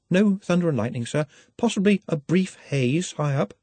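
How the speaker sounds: high, careful, deferential answer; earnest, nervous Nutt. A narrator's reading. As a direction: meekly reassure a superior.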